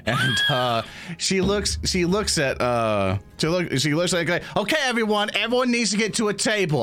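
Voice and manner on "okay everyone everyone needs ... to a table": high-pitched, no-nonsense matriarch; bossy kobold mama. Acted. Kobold mother ordering her horde to tables, bossy. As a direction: herd an unruly brood in a high voice.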